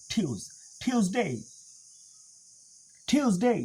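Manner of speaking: "ঠিউজ" with aspirated T and yoo; correct pronunciation. Shown as a demonstration. Teacher rejects TU-es form, aspirated "টিউসডে". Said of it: "টুয়েস না".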